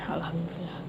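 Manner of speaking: whispered, catching breath after spice; mouth burning from spicy food. Real, not acted. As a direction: breathe out the word, still burning. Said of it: "pedes banget". (bisikan lega kepedasan)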